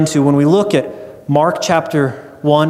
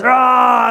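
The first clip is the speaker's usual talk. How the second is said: higher, louder monster roar; hammed-up devil roar in a story; 6 semitones higher, 4 dB louder than usual. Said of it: "And the devil roars".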